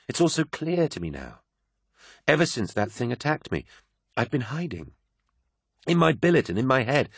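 a hint of a converted believer pouring her heart out; a friend confessing her new faith, fervently; devout woman. Euphrates voiced, devout confession.

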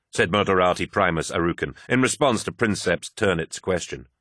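usual speaking voice

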